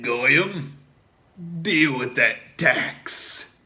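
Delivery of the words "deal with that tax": slow, stretched mocking drawl; drawn-out taunt. Host taunting his listeners, very slow and stretched. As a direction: drag every word out to rub it in. Mock rant podcast host.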